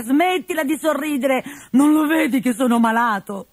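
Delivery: put-on old master complaining to his servant, whiny and rising; grumbling old Pantalone. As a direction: grumble like a miserly sick old man. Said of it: "Pantalone brontolava".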